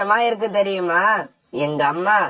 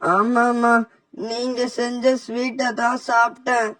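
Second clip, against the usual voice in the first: higher, strained voice of a man in agony; poisoned and in pain; 3 semitones higher than usual. Acted. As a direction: push the words out through the burning pain. Poisoned son answers his mother. (வலியில் துடிக்கும் மகனின் உயர்ந்த குரல்)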